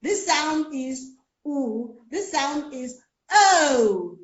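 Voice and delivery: drawn out "oo" and "o" sounds, slow; children's wrong sounds. Retold or imitated. Children repeating the teacher, slow wrong sounds. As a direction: mimic the children's mispronounced sounds. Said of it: "It's supposed to be o".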